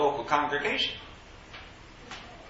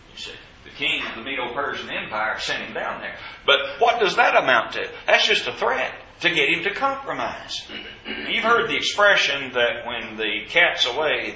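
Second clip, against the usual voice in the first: ordinary talk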